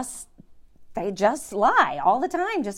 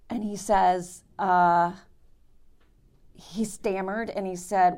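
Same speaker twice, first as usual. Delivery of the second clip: normal talking voice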